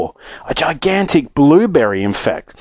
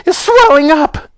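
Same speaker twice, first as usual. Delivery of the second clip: very high-pitched panicked exclamation; horrified scream; 4 dB louder than usual. Acted. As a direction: shriek it in motherly horror. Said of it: "screamed Missus Beauregard".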